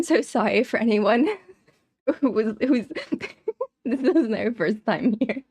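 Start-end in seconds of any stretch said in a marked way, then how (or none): 2.9-3.8 squeaky giggle; a burst of high laughter. Real, not acted.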